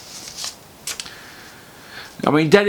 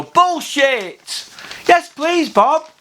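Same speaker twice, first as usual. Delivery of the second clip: chirpy mock contestant, much higher, cheeky punchline; 12 semitones higher, much slower than usual. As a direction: blurt it like a game-show answer. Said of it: "you'd go".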